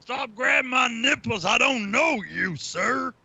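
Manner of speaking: shouted, very high and drawn out; hammed-up outraged yelling, slow and piercingly high; Josh Fisher. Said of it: "spot on impression".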